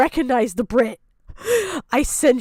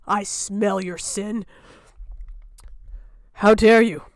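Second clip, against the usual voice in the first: usual speaking voice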